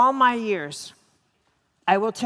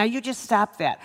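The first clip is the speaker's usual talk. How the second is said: ordinary talk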